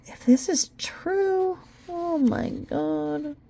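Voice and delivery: slow, hushed, high whisper of disbelief; a stunned whisper to herself; shocked whisper. Real, not acted. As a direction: breathe it out in shock. Shocked by what she reads.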